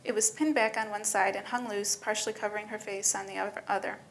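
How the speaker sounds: plain reading voice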